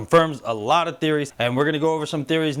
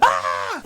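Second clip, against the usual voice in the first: high-pitched mock shriek; comic scared scream; 11 semitones higher than usual. Terrified commander facing guns, comic high scream. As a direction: let out a hammed-up yelp of terror.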